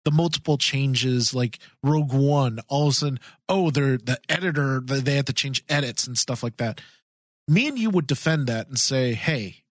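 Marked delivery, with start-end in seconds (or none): none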